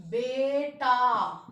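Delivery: बेटा said very slowly and firmly; slow careful word. Shown as a demonstration. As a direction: spell out बेटा by sound. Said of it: "ध्यान से सुनना". (बेटा धीरे-धीरे साफ़ उच्चारण)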